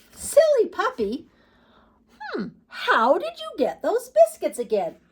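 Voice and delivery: high, loud playful scolding; little girl calling. Acted. Little girl calling her puppy back, high playful scold. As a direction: call after a naughty puppy.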